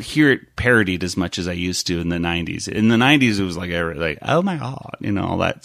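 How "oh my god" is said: put-on valley girl. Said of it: "Valley Girl".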